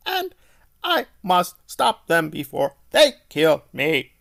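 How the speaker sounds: lightly voiced halting robot, broken grammar. Reviewer mocking the villain's halting speech, lightly robotic. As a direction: chop it up like a malfunctioning robot villain. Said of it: "he sounds like a broken robot".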